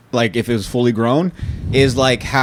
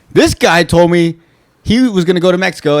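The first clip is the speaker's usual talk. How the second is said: usual speaking voice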